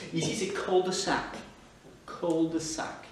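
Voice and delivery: cul-de-sac said slowly the English way, sounding the L: cull-de-sack; English pronunciation. Shown as a demonstration. Teacher demonstrating the English cul-de-sac to French learners, slow English accent. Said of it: "Il faut dire le L".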